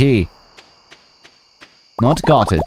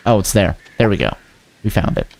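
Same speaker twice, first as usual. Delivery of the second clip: ordinary delivery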